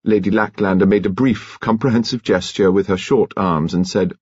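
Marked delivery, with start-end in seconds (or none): none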